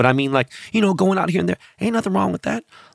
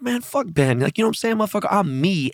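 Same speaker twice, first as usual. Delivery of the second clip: lightly voiced, higher-pitched girlfriend; sassy girlfriend; 8 semitones higher than usual. The friend's girlfriend badmouthing Ben, lightly imitated higher voice. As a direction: imitate her higher, with a touch of attitude. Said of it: "she had so much personality. She was like".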